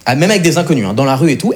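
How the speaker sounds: normal talking voice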